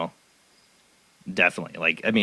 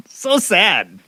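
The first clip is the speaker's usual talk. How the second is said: mock pity; 6 semitones higher than usual. Mocking a rich man's sadness.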